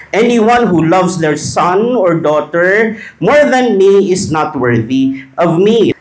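slow, loud, deliberate reading of the verse; solemn scripture reading. Shown as a demonstration. Preacher reading Jesus' words to the congregation, slow and loud. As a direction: read each word out slowly to the congregation. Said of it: "Matthew ten thirty-seven reads".

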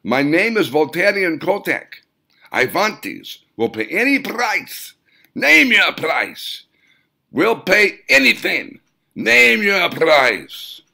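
high loud overbearing gnome-like client. A narrator's reading. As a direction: push your price at him like a pushy gnome. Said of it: "In a overbearing manner".

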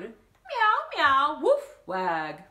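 high, loud meow and woof; animal noises. Acted. Bob the rooster repeating his new animal sounds. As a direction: show off every sound he learned.

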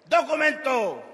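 high, loud official demand; loud, drawn-out order; policeman's command. Acted. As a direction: bark it out like a traffic cop. Policeman demands papers, botched first take.

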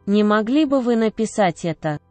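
ordinary talk

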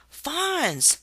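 high, delighted cry; happy exclamation. A narrator's reading. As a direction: squeal with joy at the fawns. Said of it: "she happily exclaimed".

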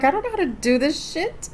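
imitating herself fed up with her nails; exasperated quote. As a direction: throw up your hands in a high, fed-up voice. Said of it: "I'm like".

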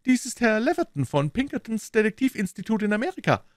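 very high character voice; police detective making introductions. Acted. Detective introduces a colleague to Holmes, high voice. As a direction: introduce him in a high put-on voice.

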